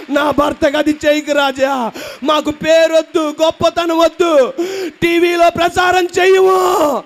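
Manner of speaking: wife praying to God, desperate pleading shout; desperate pleading prayer. Retold or imitated. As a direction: beg God on your knees, rising to a wail. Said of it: "మోకాలుంచి ఇలాగందంట".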